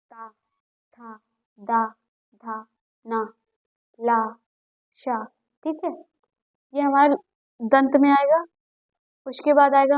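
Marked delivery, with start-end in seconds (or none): 0.1-5.2 ता, था, दा, धा, ना, ला, शा said slowly and softly, one by one; slow soft syllable demo. Shown as a demonstration. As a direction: sound each syllable slowly for the class. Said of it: "आप बोल के देखिए". (धीरे और हल्के से अक्षर बोलना)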